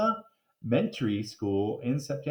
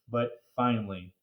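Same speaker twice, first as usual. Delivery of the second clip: usual speaking voice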